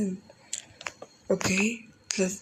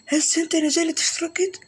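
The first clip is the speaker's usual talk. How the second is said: insistent high question; 8 semitones higher than usual. Real, not acted. Gamer pressing a viewer to subscribe, insistently. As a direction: pitch jumps up on هيد, pressing the viewer.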